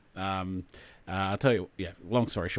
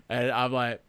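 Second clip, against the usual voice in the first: ordinary delivery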